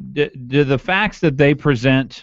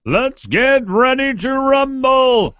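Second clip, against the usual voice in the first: much higher, drawn-out ring announcer call; boxing announcer; 12 semitones higher, slower than usual. Pretend.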